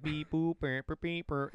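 playful mock instrument beeps; imitated electronic beeps. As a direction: make toy synth noises with your mouth. Imitates mayonnaise instrument noises.

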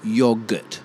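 YOGURT as "YOGG-urt"; short O, firm G in Yogurt; double-G pronunciation. Shown as a demonstration. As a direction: Yogurt as if spelt yoggurt. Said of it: "it would be double G".

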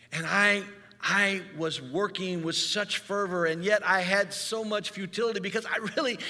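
fervent sermon delivery; preaching at full force. Acted. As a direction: drive the story home to the congregation.

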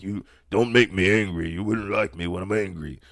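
a hint of a low, brooding Hulk; understated Hulk threat. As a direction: lightly growl the warning like the Hulk. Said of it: "they pull the Hulk".

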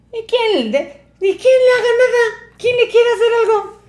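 very high, loud mock-crying whine; whiny denial, imitated. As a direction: wail innocence in a hammed-up falsetto. Cornered man whining his denial. (lloriqueo agudísimo de cobarde, imitado)

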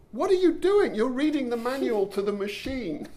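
high, loud exclamation of disbelief; mock incredulous. Challenging the teacher who reads to a machine, mock incredulous. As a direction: exclaim it as if baffled by the absurdity.

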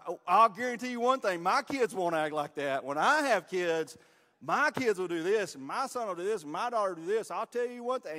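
loud, high, know-it-all boasting; mock smug promise, loud and sure; cocky young couple. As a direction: brag like you've got parenting figured out. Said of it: "the conversation on the way home".

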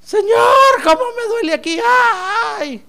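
lamento agudo de llorón; lloroso. Acted. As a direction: quejarse a gritos, imitando un llanto exagerado. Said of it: "Va a venir usted acá a culto a llorar". (llanto fingido y quejumbroso)

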